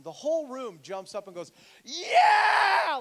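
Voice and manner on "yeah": huge high roar of celebration; cheering yell. Retold or imitated. Preacher mimics the congregation at a conversion, wild cheering yell. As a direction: yell it out like a whole room erupting. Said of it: "just started yelling and cheering".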